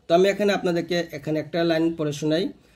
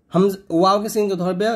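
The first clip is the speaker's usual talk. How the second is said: ordinary talk, nothing marked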